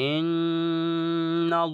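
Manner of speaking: ইন্নাল্লাহ drawn out very slowly, heavy ল; recited heavy. Shown as a demonstration. A Quran teacher to students, reciting a heavy example. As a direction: chant ইন্নাল্লাহ slow and full. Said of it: "যেমন".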